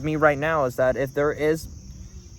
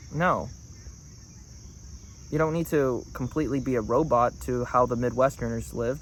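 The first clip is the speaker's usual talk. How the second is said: ordinary talk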